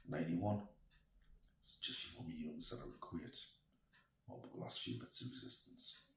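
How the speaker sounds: normal talking voice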